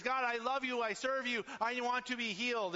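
high, loud desperate plea; crying out to God. Retold or imitated. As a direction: beg God at the top of your voice. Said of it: "he cried out to God".

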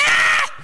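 very high, loud scream; mock falling scream. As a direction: scream as if dropping off a cliff. Man falling off cliff.

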